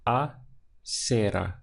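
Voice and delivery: acera said slowly, syllables drawn out; slow Spanish word. Shown as a demonstration. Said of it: "Just two syllables".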